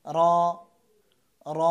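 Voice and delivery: র as a heavy "ro" with one vibration; single-tap r. Shown as a demonstration. Teacher showing one vibration only, single-tap r. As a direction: tap the tongue once on র. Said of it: "একবার কম্পন হবে".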